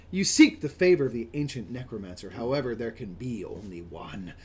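loud opening sinking into a low whispery hush; dramatic dark-fantasy narration. Acted. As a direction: intone it like an ominous necromancer's proclamation. Reads game's fantasy blurb in character.

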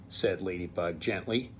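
ordinary delivery, nothing marked

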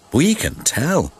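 usual speaking voice

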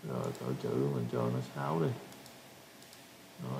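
ordinary talk

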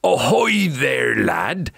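slow, drawn-out pirate hail; pirates crying out. A narrator's reading. Pirates greet Jack at the docks, hearty pirate call. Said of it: "the pirates cried".

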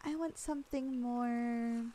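ordinary delivery

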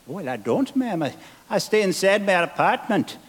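a hint of a Scottish boy; Scottish lad. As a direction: son reassuring his mother, lightly Scottish. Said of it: "son's reply in joke".

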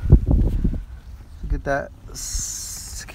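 slow, breathy-free hush of awe; awed whisper. Real, not acted. Streamer showing viewers the car, in a slow awed whisper. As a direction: drag the words out in a hushed whisper.

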